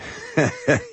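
brief amused laugh; short chuckle. Acted. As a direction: chuckle at a slow pupil. Teacher laughs at slow pupil.

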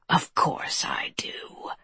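very low, slow, soft whisper; creature whisper. A narrator's reading. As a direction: draw it out in a deep whisper. Creature keeps whispering to girl.

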